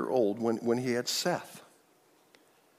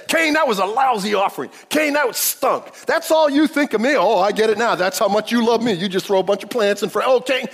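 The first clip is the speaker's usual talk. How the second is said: higher and louder, hammed-up scolding of Cain; mock angry God; 8 semitones higher, 9 dB louder than usual. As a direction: play an offended God snapping at Cain. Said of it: "God didn't say".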